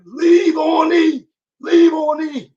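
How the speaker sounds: high, drawn-out preacher yell, imitated; Eric Thomas. Imitating a hip hop preacher's motto, hoarse high yell. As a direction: holler it slowly like a hoarse motivational preacher. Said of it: "voice all hoarse".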